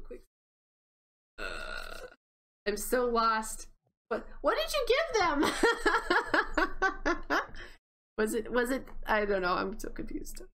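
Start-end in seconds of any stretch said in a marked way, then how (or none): 4.1-5.4 high, incredulous cry tipping into laughter; amused shock. Real, not acted. Confused player questions what was given, amused shock. 5.5-7.7 long, high burst of laughter; laughing fit. Real, not acted. Player cracks up at her own confusion, laughing.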